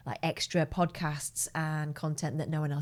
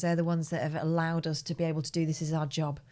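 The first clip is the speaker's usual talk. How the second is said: ordinary talk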